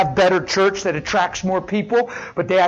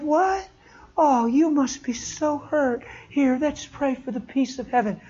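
mock sympathy; 4 semitones higher, 6 dB quieter than usual. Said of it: "She said".

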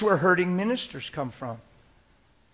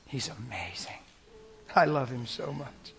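breathy whisper, much softer and lower; awed whisper; 3 semitones lower, 5 dB quieter than usual. Real, not acted. Preacher confiding his love for Jesus, whispering. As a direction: whisper it in wonder. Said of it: "preacher whispering his devotion".

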